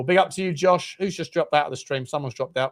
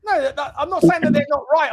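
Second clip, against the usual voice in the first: laughing protest; 9 semitones higher, 5 dB louder than usual. Real, not acted. Defending himself, laughing.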